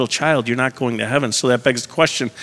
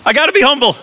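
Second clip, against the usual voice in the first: put-on much higher, childlike little baby voice; 12 semitones higher than usual. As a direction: squeak it out like a toddler confessing. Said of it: "He's like".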